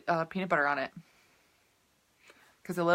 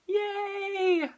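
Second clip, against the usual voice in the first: high little cheer; 13 semitones higher than usual. Real, not acted. Cheering for carbs to her viewers, high and excited. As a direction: squeal it with glee.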